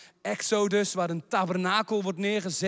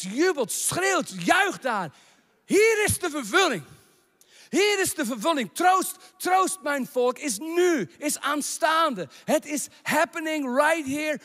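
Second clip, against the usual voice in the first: full-force preaching; 6 semitones higher than usual. Acted. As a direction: rise up and proclaim it to the hall. Preacher proclaiming fulfilment to congregation.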